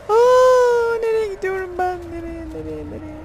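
fleeing in fear; panicked whimper. Real, not acted. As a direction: lose it in panic. Panicked crying out.

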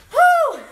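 high, loud puff of breath after effort; tired whew. Real, not acted. Exhaling mid-workout.

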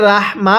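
ordinary talk, nothing marked

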